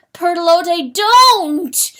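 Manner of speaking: loud, high anguished wail; wailing cry. Acted. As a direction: cry out her name in despair. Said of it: "the rooster wailed".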